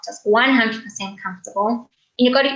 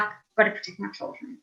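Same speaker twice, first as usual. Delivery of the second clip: breathy whisper, much softer; 2 semitones lower, 9 dB quieter than usual. Shown as a demonstration. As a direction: hush it like an inner vow. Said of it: "This is like".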